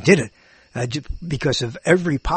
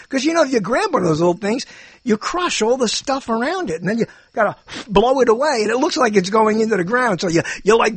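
ordinary delivery

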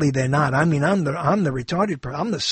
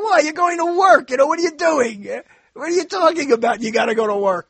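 incredulous; 9 semitones higher, 3 dB louder, faster than usual. Retold or imitated. Incredulous retort to doomsayers.

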